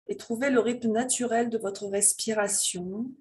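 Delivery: slow, calm voice leading a breathing meditation; guided meditation. Real, not acted. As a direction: guide them gently into their breath, unhurried, drawing out phrase endings.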